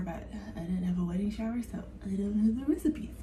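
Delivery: hushed, breathy whisper, voice dropped right down; whispered aside. Real, not acted. Admitting to viewers she never had a wedding shower, whispered aside. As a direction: confide it in a whisper like a wry secret.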